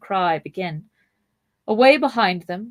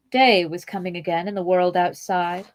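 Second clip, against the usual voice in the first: ordinary delivery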